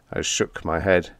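usual speaking voice

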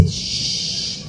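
a whispered shh; shushing whisper. Retold or imitated. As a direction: hush him through the wall. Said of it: "Alicia dice".

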